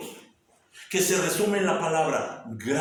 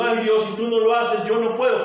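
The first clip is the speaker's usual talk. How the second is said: higher, louder mock whining plea; pleading whimper; 7 semitones higher, 7 dB louder than usual. Preacher mocking defeated people crying to God, mock whining plea. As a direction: whine to God like a defeated child.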